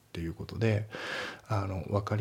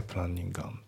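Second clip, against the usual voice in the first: ordinary delivery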